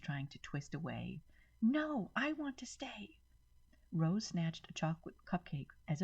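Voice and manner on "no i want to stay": put-on high, slow child's whine; a girl refusing to leave; whining child. A narrator's reading. Maddie whining as she is dragged, high and slow. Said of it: "Maddie screamed".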